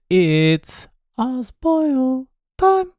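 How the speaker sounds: host announcing the show's segment, hammed up; show announcer. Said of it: "announcing segment".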